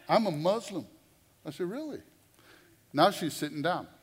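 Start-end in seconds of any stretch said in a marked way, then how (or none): 0.1-0.9 higher, louder, proud statement; defiant declaration. Retold or imitated. Inmate telling the preacher her faith, defiant and raised. 1.7-2.0 much higher, quick surprised "Really?"; mock surprise. Preacher answering the inmate, hammed-up surprise much higher. Preacher reacts to her faith.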